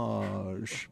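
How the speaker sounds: dressage slow and low in a French accent. Shown as a demonstration. Guest demonstrating the word for the host, French accent. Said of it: "Let's put a French accent".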